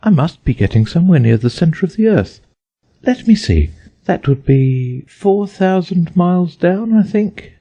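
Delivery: put-on a little girl working out sums; young Alice. A narrator's reading. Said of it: "she said aloud".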